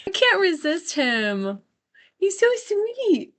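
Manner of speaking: high, slow, melting coo; gushing over puppy. Real, not acted. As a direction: melt over the adorable puppy, drawn out. Host gushing over her puppy.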